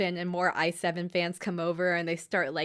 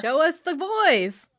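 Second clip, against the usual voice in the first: louder, higher, drawn-out cheer; mock demand; 7 semitones higher, 5 dB louder, slower than usual. Podcast host demanding her favorite characters, mock demand. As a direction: call for them like an impatient fan.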